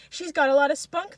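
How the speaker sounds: high, loud crowd holler; shouting. A narrator's reading. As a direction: call it out over the mob. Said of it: "they shout".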